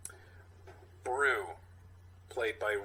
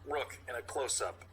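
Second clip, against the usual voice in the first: usual speaking voice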